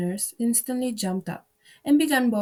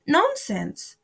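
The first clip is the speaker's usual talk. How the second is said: firm, decided retort; loud and decided; 6 semitones higher than usual. A narrator's reading. Alice defies the queen, loud and decided. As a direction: stand your ground firmly. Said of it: "very loudly and decidedly".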